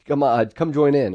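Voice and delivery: rally friends to come join the fun; eager and upbeat, waving everyone over; fun inviting call. Retold or imitated. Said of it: "fun, people-oriented words like".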